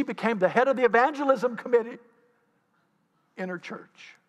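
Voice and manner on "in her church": usual speaking voice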